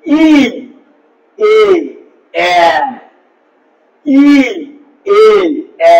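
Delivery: ই and এ each said alone, lips spread wide; teacher showing spread vowels; spread vowels demo. Shown as a demonstration. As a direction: sound out ই, এ, এ one at a time. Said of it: "প্রসারিত হচ্ছে".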